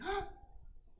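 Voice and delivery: high, breathy storyteller's gasp; sharp surprised intake of breath; dramatic gasp. Acted. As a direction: gasp in mock surprise for the kids. Snake suddenly sees the cat.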